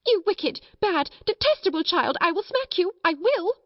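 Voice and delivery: high, frantic scolding; furious threat. A narrator's reading. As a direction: lose all patience with the child. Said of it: "first one method and then another".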